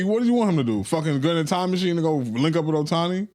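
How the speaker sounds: ordinary delivery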